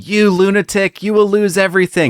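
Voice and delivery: high, mock-screamed threat; screaming like a lunatic. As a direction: rant it like a raving villain. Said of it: "he's screaming at him like a lunatic".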